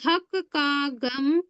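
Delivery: mnemonic line spelled out slowly; dictating a memory line slowly; slow recital. Shown as a demonstration. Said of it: "एक line याद कर लेना".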